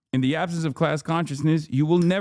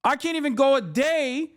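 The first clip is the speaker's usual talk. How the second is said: heated rant; 9 semitones higher than usual. Real, not acted. Still ranting at chat.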